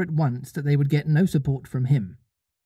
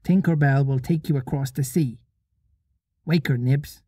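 commanding. Acted. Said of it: "short, sharp voice of one accustomed to be obeyed".

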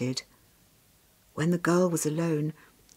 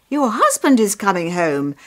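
loud, glad villagers' news; celebrating neighbour, loudly announcing; excited announcement; 2 semitones higher, 9 dB louder than usual. A narrator's reading. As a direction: call out the happy news. Villagers announce good news to mother.

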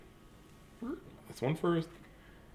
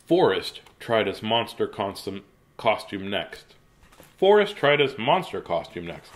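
usual speaking voice

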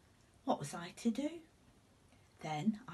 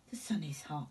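whisper; 2 semitones lower than usual. Acted. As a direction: murmur it under your breath in the heat. Narrator whispers about the heat.

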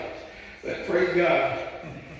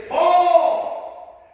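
a sudden raised cry, much higher and louder; 7 semitones higher, 5 dB louder than usual. Retold or imitated. Festus shouting at Paul before the king, loud cry. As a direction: Festus bursts out, calling across the court. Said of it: "with a loud voice".

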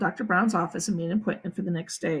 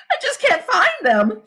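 high, raised whine; frustrated girl; 11 semitones higher, 4 dB louder than usual. A narrator's reading. Sheila searching the ground, high frustrated whine. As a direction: complain it loudly in distress.

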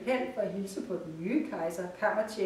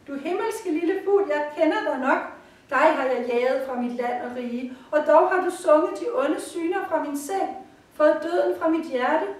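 higher, louder heartfelt praise; fervent gratitude; 6 semitones higher, 6 dB louder than usual. Acted. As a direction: pour out thanks to the bird. Emperor's emotional gratitude.

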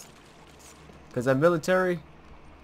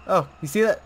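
alarmed; 10 semitones higher, 9 dB louder, faster than usual. Real, not acted. Repeated in alarm.

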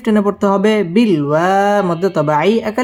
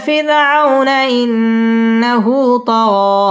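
slow, drawn-out tajweed chant; measured Quranic recitation; 4 semitones higher, much slower than usual. Shown as a demonstration. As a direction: recite slowly, stretching the long vowels. Teacher recites the verse.

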